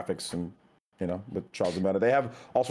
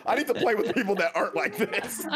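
laughing; 10 semitones higher than usual. Retold or imitated. Mock rant, laughing.

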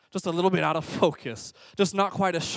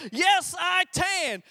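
put-on high, drawn-out little-kid yell; mock toddler scream; 11 semitones higher, much slower than usual. Toddler screams it back to dad, high mock scream. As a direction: imitate a toddler screaming it slowly. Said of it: "Scream it".

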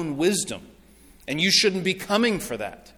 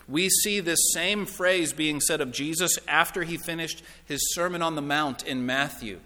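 ordinary talk, nothing marked

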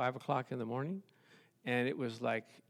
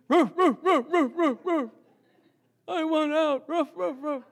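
high, loud put-on dog voice barking ruff; 12 semitones higher, 8 dB louder than usual. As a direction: bark it out like a whining dog wanting out. Pastor imitates the barking dog.

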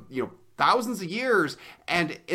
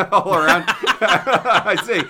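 louder, words through laughter; can't stop laughing as you talk; laughing hard; 2 semitones higher, 8 dB louder, much slower than usual. Real, not acted. Cohost laughs loudly at the host's gross comparison.

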